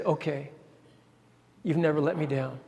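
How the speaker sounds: quiet concession. Retold or imitated. Administrator quietly relenting to the coach, soft and low. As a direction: let it go softly, trusting him.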